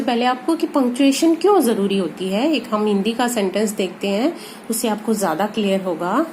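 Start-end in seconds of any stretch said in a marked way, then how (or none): none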